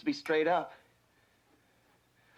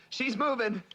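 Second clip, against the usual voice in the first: rising, higher elated calls; growing excitement. Real, not acted. Rescuer announcing the baby is coming, excited. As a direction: build the joy line by line.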